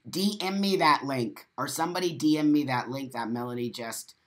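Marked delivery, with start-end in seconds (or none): none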